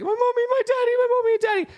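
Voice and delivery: very high, loud mock wailing child; squeaky put-on British child in tears; crying little girl. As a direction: wail like a distraught little girl, hammed up. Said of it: "and she's, like, crying".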